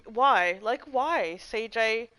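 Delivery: ordinary talk, nothing marked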